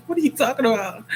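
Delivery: laughing through the question; amused disbelief. Real, not acted. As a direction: giggle while asking.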